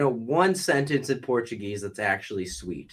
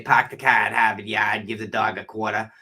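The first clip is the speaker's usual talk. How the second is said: dropped-R Boston accent; thick Boston accent; 2 semitones lower than usual. Shown as a demonstration. Host shows what he avoids, thick Boston accent. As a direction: put on a trashy heavy Boston accent. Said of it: "heavy accent".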